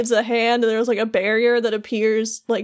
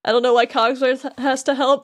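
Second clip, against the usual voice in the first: normal talking voice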